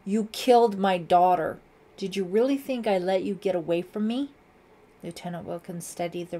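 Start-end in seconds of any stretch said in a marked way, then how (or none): 0.0-4.3 loud, vengeful confrontation; angry accusation. A narrator's reading. Father confronts daughter's accused killer, angry shout. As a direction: hurl the accusation at full voice. Lieutenant accuses suspect at gunpoint.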